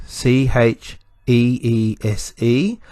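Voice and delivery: C H E E S E letter by letter, slowly; spelled out. Shown as a demonstration. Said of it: "spell the word out".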